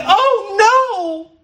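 hammed-up high squeal; mock fearful oh no; mock panic. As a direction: shriek it like a worrier. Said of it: "Have you seen".

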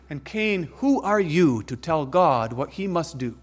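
usual speaking voice

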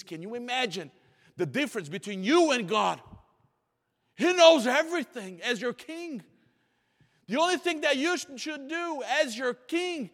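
fervent pulpit cry; full-force preaching. Acted. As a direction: build the congregation up to a climax.

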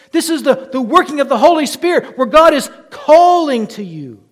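loud, high, rising pulpit cry; preaching at full force. Acted. Preacher urging the congregation, preaching at full force. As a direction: build the fervour with each phrase. Preaching on verses leaping to mind.

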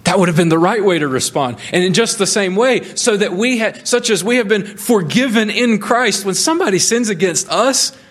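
high, ringing preacher's voice, pressing each phrase; full-force preaching. Acted. Preacher urging the congregation to grateful joy, ringing and fervent. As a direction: preach it out with fervour.

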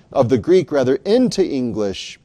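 ordinary delivery

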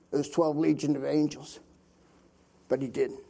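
ordinary talk

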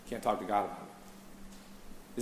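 slow, hushed, almost to himself; hushed aside. Real, not acted. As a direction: drop to a sorrowful hush. Aside after mock accusation.

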